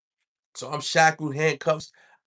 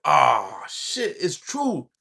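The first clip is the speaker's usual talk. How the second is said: scared resignation; much slower than usual. Retold or imitated. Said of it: "I'm scared to death".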